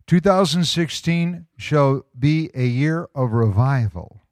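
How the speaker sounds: measured, emphatic read-aloud; slow, deliberate reading. Real, not acted. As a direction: proclaim it to the congregation, word by word. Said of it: "gonna read it kinda slow".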